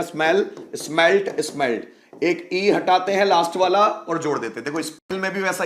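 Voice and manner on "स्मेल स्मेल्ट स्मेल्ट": स्मेल्ट spoken slowly, with a clear final t; slow demo pronunciation. Shown as a demonstration. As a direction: stretch स्मेल, then land on स्मेल्ट. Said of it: "स्मेल का थोड़ा सा देखना पड़ेगा". (धीमा उच्चारण, स्मेल्ट में साफ़ टी)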